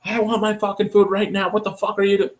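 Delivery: high, mock drunk customer ranting; imitating a drunk customer yelling through the speaker. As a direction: rant like an angry drunk at the drive-thru. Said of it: "They're like".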